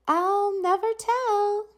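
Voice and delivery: high, sly and smiling; smiling secret. A narrator's reading. Teacher to herself about her secret, smiling. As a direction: keep the secret with a grin. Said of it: "she said to herself with a smile".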